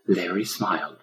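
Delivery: ordinary delivery, nothing marked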